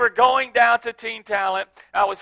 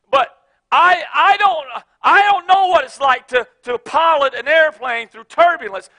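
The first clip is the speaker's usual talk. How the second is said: loud, rising preacher's exhortation; preaching at full force; 4 semitones higher, 4 dB louder than usual. Real, not acted. Pastor urging the congregation to let go, full-force preaching. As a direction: drive it home to the congregation.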